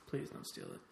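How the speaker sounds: mock-secret whispered plea. Repeats plea, then whispers it.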